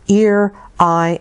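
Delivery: ordinary delivery, nothing marked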